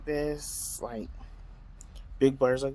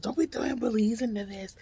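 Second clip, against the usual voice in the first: higher, mock offended voice of a stranger; indignant bus rider; 9 semitones higher than usual. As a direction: play the offended guy jumping up. Man in back jumps up.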